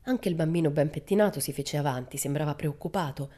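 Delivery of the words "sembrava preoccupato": ordinary delivery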